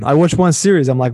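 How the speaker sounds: ordinary talk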